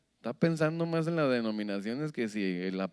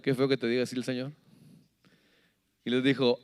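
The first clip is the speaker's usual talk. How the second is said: usual speaking voice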